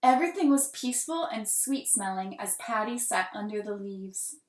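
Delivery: ordinary delivery, nothing marked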